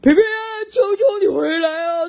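提高嗓门的恳求呼喊; 爸爸朝天上急切地喊; 哀求地喊. A narrator's reading. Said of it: "爸爸也对他喊着说". (爸爸朝天上的皮皮哀求喊)

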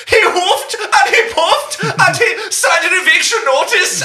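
put-on loud, high, slow German-accented pig; high squeaky German pig voice. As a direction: ham up the little pig's German accent. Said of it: "the little pig goes".

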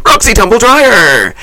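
high, slow showbiz announce; announcer voice. Acted. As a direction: draw out the name grandly. Host announcing her own name.

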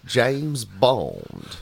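put-on slow, drawn-out Southern accent as Bond; Benoit Blanc Southern drawl. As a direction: drag out Bond's lines in a lazy Southern accent. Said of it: "in the voice of Glass Onion Man".

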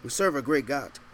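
normal talking voice

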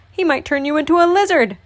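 fast and high; playful warning. A narrator's reading. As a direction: rattle off the warning gleefully.

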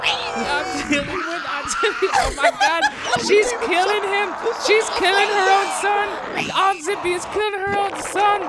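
high shrieking panic; panicked screaming. Acted. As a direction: scream it in terror.

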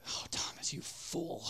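mocking whisper. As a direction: whisper the taunt. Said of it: "mocking doubt".

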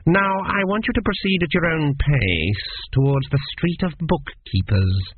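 level, slow, cold instruction; even examiner. Acted. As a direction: give orders without emotion. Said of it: "said Meriset evenly".

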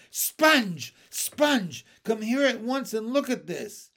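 high, loud excited calling; shouting. Acted. Spiker shouting to Sponge about the peach, loud and high. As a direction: yell across the garden for her sister. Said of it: "Aunt Spiker was shouting".

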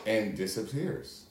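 usual speaking voice

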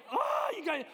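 high-pitched excited squeal; overjoyed shout, pitch soaring; amped up. Retold or imitated. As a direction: gush it, completely amped. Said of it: "I was so amped".

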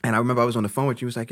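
ordinary talk

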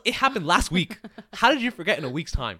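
loud, high-pitched incredulous protest; exasperated retort. Retold or imitated. Retelling an argument with a forgetful friend, exasperated.

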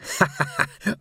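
short high mocking "ha ha"; acted taunting laugh. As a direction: laugh in the captive's face. Taunting a bound captive.

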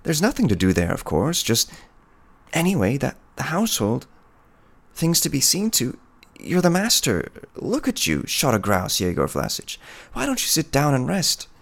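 lightly voiced flustered woman, pitch climbing high; a touch of foolish, nervous happiness; giddy peasant woman. A narrator's reading. Woman coaxing the hunter to stay, high and giddily flustered. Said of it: "Pelageya laughs like a fool".

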